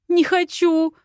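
high, loud whiny "Не хочу"; whining. Acted. Heroine whining at Viktor, refusing the task. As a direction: whimper like a child refusing. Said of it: "захныкала".

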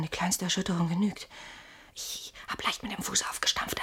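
soft, voiceless, tense whispering; frightened whisper. Acted. Man whispers to his friend so the intruder outside won't hear. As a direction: whisper so he can't hear you.

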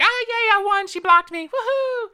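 hammed-up squeal of fake victory; mock celebration. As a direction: cheer like you've won a prize, mocking. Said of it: "and I'll be like".

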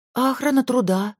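high, honeyed question; sweeter still. A narrator's reading. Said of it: "ещё ласковее спросила Зоя". (ещё ласковее, высоким голосом)

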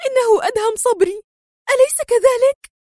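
very high, excited question; urgent high-pitched exclamation; agitated cry. A narrator's reading. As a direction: blurt it out in agitation. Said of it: "وهي تهتف في انفعال".

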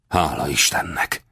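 relieved, softened exclamation; understated heartfelt relief; sigh of relief. A narrator's reading. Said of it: "szakadt ki belőle a megkönnyebbülés sóhaja".

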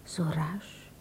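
hushed inner thoughts. Acted. Woman alone, wondering why he hurried away, whispered. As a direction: breathe it as a private thought.

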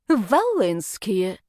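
drawn-out name, spat with contempt; disgusted rector. Acted. Rector hears the hated family name, disgusted. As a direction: slowly spit the family name out.

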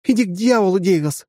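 high, loud furious retort; angry swearing. Acted. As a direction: snap back at him in anger. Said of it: "выругалась девушка".

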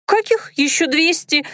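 high, cheeky squeak. A narrator's reading. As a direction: snap back cheekily. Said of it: "начала девка развязно".

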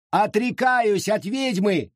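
high, slow shouted vow; shouting. A narrator's reading. Rebecca shouts to the crowd, high and slow. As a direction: cry out your renunciation. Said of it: "крикнула и Ребекка".

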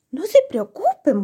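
high little-hero voice; Pulgarcito. A narrator's reading. Pulgarcito to his frightened older brothers, high and reassuring. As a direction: reassure them in a small, high voice. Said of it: "volvió a decirles Pulgarcito".

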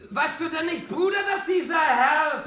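exasperated near-shout; insistent shout. Acted. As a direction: hammer the point home at him. Repeats louder to brother.

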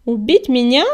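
loud, insolent echo question. A narrator's reading. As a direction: throw it back brazenly. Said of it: "нагло переспросил Коготь".

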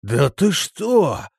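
high, slow, drawn-out astonishment; stunned disbelief; greatly amazed. A narrator's reading. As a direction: stretch it out, floored by the news. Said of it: "сильно изумился Крепыш".

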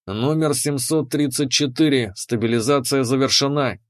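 ordinary delivery, nothing marked

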